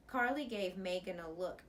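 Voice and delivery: usual speaking voice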